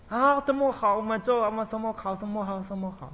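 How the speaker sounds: high, bright fake eagerness; hammed-up eager agreement; mock enthusiasm. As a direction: overplay a cheerful yes you don't mean. Mocking fake enthusiasm of group.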